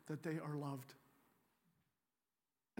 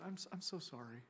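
hushed sympathy; 10 dB quieter than usual. Retold or imitated. Comforting an abused woman on plane.

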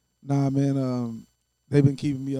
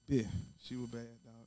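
normal talking voice

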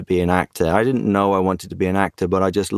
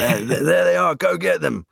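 high, urgent battlefield call; rally the troops, pointing the way; commanding shout to the squad; 9 semitones higher than usual. Shown as a demonstration. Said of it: "waving my arms around, pointing in directions".

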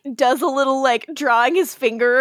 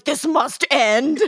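mock dramatic; 3 semitones higher, much slower than usual. Catherine quoted in a show recap.

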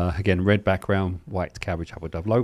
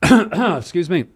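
clearing a choked throat; coughing through a choke; choking; 9 semitones higher, 10 dB louder, much slower than usual. Real, not acted. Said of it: "Choking, dying over here".

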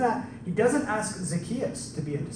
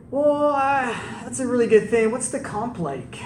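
mock hesitant stalling, pitched higher; udawane wahanie; 5 semitones higher than usual. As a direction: play a reluctant recruit sizing up an offer. Acts out Matthew haggling with Jesus.

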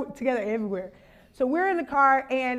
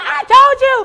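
high, loud I-told-you shout; gloating scream; 10 semitones higher, 11 dB louder than usual. Retold or imitated. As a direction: shout it triumphantly, much higher. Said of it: "we're just screaming".

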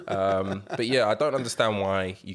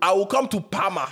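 high, loud Nigerian parent threat; 11 semitones higher, 7 dB louder than usual. Pretend. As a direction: roar it like an angry African dad. Continuing the Nigerian parent bit.

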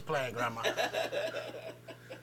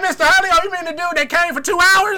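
much higher, loud mocking kid; mock student voice; 11 semitones higher, 6 dB louder than usual. Students mocking the short-lived teacher, high loud mock voice. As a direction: tease like a snarky student.

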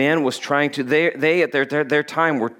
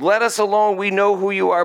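higher-pitched demon's cry, retold; mild shout; 5 semitones higher than usual. Demon shouting at Jesus, mildly raised cry. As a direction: cry it out, short of a full shout. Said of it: "this demon shouts out".

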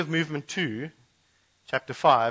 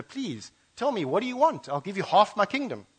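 eager king; 7 semitones higher than usual. Acted. King Xerxes begs Esther.

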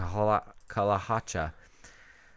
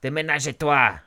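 hammed-up French accent; boasting in a mock French accent; 3 semitones higher, 5 dB louder than usual. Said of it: "The French are like".

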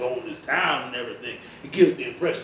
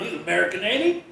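mocking; 7 semitones higher than usual. Vernon mocks Kurt.

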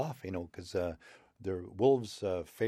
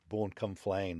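plain reading voice

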